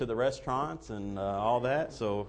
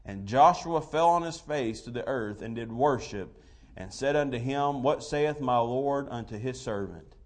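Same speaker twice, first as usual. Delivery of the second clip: scripture reading; 2 semitones higher, 4 dB louder than usual. Real, not acted. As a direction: read the King James verses with weight. Reads King James scripture aloud.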